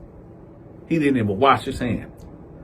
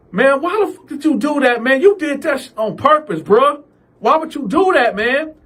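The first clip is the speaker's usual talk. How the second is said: higher, heated complaint; angry; 10 semitones higher than usual. Retold or imitated. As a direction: push up in outrage. Cal angry at sneeze.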